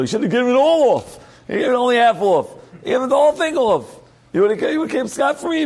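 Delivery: the wife can't believe he settled for half; exasperated wife. Retold or imitated.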